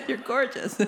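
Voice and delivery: high, giggly compliment; laughing. Real, not acted. Host teases audience about their good looks, laughing. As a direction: crack up while complimenting them.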